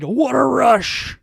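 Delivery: loud, high, drawn-out yell; catchphrase yell. Retold or imitated. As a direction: bellow it like a wrestling catchphrase. Shouting out the finisher's catchphrase.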